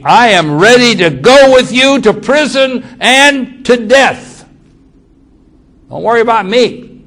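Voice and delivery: eager boast. Retold or imitated. Peter boasting his loyalty to Jesus, high and loud. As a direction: promise it proudly, voice up and loud.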